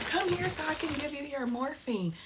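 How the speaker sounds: high, put-on nurse voice; mocking the nurse. Mom mimics nurse calling her over mid-contraction, mocking high voice. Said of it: "she go tell me".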